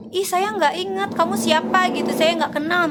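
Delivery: mock puzzled victim on the phone. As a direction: act unsure who's calling. Victim says she doesn't know.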